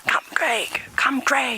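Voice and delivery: hushed, whispered beckoning; whispered call. Real, not acted. Guide whispering to companion while creeping up on a crocodile, whispered call. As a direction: whisper it to summon a companion along.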